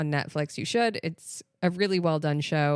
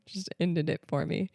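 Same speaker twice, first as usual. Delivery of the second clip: usual speaking voice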